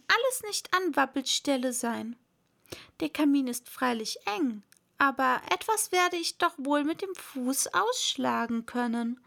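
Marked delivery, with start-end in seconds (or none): none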